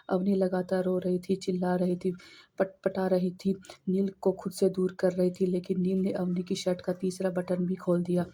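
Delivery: plain reading voice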